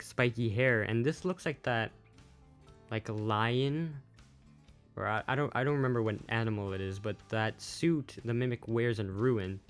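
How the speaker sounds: ordinary delivery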